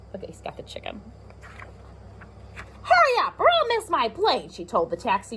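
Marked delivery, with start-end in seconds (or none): 0.1-1.0 soft secret whisper. Real, not acted. Aside to child over picture. 2.8-4.5 much higher, hurried plea; woman urging the taxi driver, lightly urgent and high. Acted.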